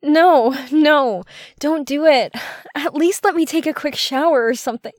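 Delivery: high-pitched, urgent protest; flustered pleading. Acted. Woman begs her partner to stop, flustered and high.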